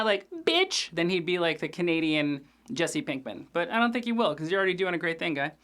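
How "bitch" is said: bitch squeaked much higher. Retold or imitated. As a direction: fling out bitch like a strung-out punk. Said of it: "if he could add".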